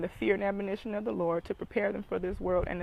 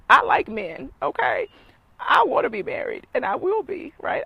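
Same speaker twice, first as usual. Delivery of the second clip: much higher and louder, laughing; excited declaration; 9 semitones higher, 10 dB louder than usual. Real, not acted. Telling single moms she wants marriage, high loud excitement. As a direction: burst out with glee.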